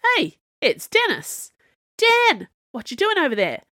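high, loud call across a hall; hollering. A narrator's reading. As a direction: crowd member yelling to a friend. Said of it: "and were hollering".

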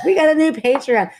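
high, loud excited squeal. Retold or imitated. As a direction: flip out with joy over it. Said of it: "we flip out every time".

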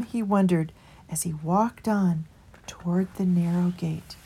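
ordinary delivery, nothing marked

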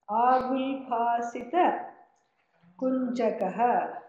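slow chant, one word at a time; staccato. Shown as a demonstration. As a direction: chant each word separately with long pauses. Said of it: "I will just chant one word at a time".

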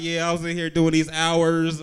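loud, slow, tough-guy boast; mock tough brother. Fake-thug brother brags about his three days in jail, loud tough-guy voice. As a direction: puff up like a hard inmate.

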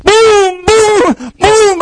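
very high excited booms; climactic blows. Retold or imitated. Preacher acting Rocky's winning punches, very high. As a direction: hit every boom up high.

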